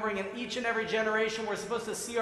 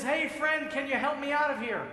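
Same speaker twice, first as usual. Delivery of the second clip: calling up; 6 semitones higher than usual. Acted. Man in pit calls up.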